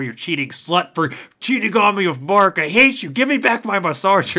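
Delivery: hammed-up outraged rant, high-pitched; mock-furious, high and loud accusation; mock jilted rage. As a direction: hurl it like a jilted lover's tantrum. Acting out jilted owner's furious rant.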